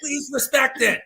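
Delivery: high-pitched mock begging; hammed-up frantic plea; mock pleading. Co-host plays small man facing bear, mock desperate. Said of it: "You're just sitting there going".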